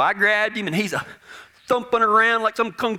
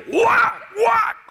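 high, punched repeated blows; shouted impact noises; 4 dB louder than usual. Acted. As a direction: hit each one like a swung plank. Acts out repeated two-by-four blows.

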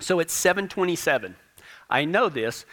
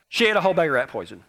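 fast blurt; 2 semitones lower, much faster than usual. Retold or imitated. Blurting confession to wife.

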